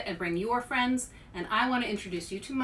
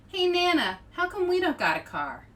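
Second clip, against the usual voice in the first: put-on higher, louder child's question; little boy; 9 semitones higher, 5 dB louder than usual. Boy calling to his grandma, higher and louder. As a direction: call out like an eager little kid. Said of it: "CJ asks his grandma".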